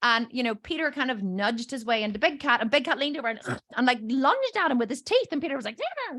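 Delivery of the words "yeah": very high little squeal; imitated high-pitched yelp; startled horse.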